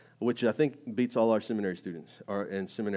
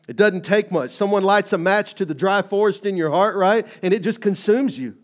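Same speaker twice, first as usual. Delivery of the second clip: loud, higher, driving sermon voice; preaching at full force; 7 semitones higher, 12 dB louder than usual. Acted. Pastor urging the congregation, loud preaching. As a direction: preach it out to the whole room.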